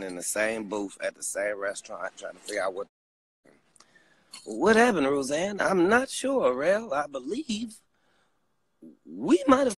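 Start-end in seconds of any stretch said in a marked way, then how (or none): 4.4-5.6 high puzzled Rel asking Roseanne; acted Rel, higher. Acting Rel in booth. 5.6-9.8 high unsure Roseanne; acted Roseanne, higher. Acting Roseanne's reply.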